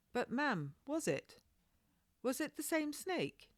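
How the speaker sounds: put-on high, quick curious child voice; a little girl hungry for the story; young girl pressing her questions; eager young girl. Child Maggie insists on an answer.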